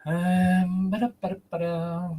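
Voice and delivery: playful sound check syllables; mic test babble. Shown as a demonstration. Host testing the moved microphone, light babbling. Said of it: "Let's see how that works out".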